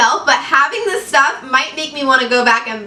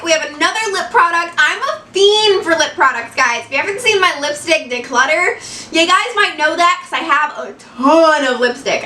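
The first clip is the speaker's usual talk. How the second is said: normal talking voice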